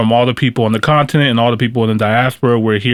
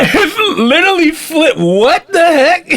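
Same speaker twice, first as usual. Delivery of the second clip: laughing. Real, not acted. Repeats while laughing.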